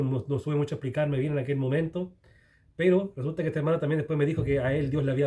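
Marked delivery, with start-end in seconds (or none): none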